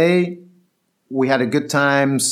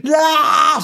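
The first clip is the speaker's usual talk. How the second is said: high, bawling mock cry; imitated tantrum howl; mock child's wail; 12 semitones higher than usual. Nine-year-old crying for another ride, mock wail. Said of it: "Why are you crying?".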